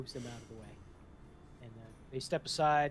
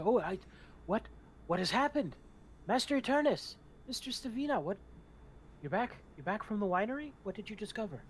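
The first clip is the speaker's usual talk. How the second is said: high, excited servant voice; a high-pitched, fussy servant, startled and eager; flustered major domo; 8 semitones higher, 4 dB louder than usual. Acted. Major domo greets the returning party.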